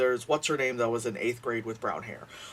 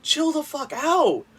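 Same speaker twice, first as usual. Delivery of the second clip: higher, slow, drawn-out chill out; exasperated; 13 semitones higher, 5 dB louder, slower than usual. Real, not acted. The vlogger scolding the heartless group, slow exasperated plea. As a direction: stretch it out in disbelief.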